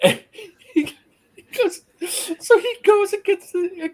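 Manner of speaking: helpless high-pitched laughing speech. Real, not acted. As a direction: squeeze the words out through laughter. Still cracking up while resuming.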